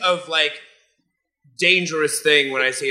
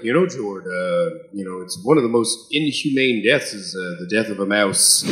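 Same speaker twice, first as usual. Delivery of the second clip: deep, lowered dad lecturing voice; 9 semitones lower than usual. Retold or imitated. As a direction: do your dad, low and earnest. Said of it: "My dad would be like".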